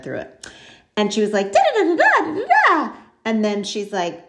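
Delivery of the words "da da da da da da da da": mocking gushing praise. Mocking her over-the-top praise.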